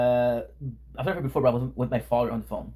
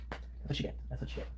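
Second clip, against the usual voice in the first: very soft, whispered aside; hushed golf-club voice; 15 dB quieter, much faster than usual. Pretend. Streamer judging a song as if at a golf club, whispering.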